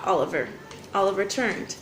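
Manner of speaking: ordinary delivery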